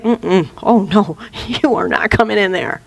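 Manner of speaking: lightly voiced unbudging bouncer. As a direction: turn them away at the door. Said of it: "the bouncer's like".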